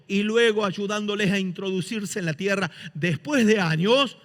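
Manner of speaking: normal talking voice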